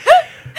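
shrieking giggle; loud high laugh; high squeal laugh. Real, not acted. Laughs while retelling.

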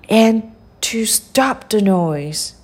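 plain reading voice

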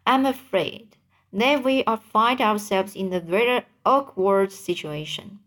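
ordinary delivery

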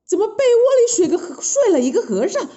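sharp gasp, then high, loud alarm; high, startled outburst; startled queen. A narrator's reading. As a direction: shriek in shock on waking. Queen wakes beside bald man.

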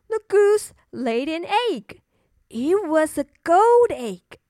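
ordinary delivery, nothing marked

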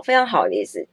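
ordinary delivery

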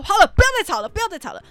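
loud high scolding shout; mom shouting stop. Retold or imitated. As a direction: yell to stop the fight. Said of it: "然后我妈就说". (大声喝止吵架)

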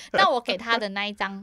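usual speaking voice

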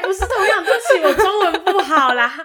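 loud, high embarrassed laughter; laughing. Real, not acted. Embarrassed laughing apology, foreigner joke.

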